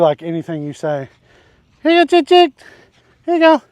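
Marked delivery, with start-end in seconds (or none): 1.8-3.6 high, loud feeding call; calling chickens. Real, not acted. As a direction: coax the hens over in a high call.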